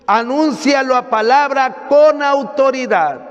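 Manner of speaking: emphatic sermon in long, insistent phrases; preaching. Acted. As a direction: drive it home to the congregation. Preaches to a congregation.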